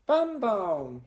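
put-on high, loud toddler glee; delighted little child's yell; gleeful shout. A narrator's reading. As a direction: crow it out happily. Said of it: "schreit sie vergnügt".

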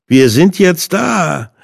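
drawn-out sneer; mocking call. A narrator's reading. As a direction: Hinde calls out slow and high. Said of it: "rief er spöttisch".

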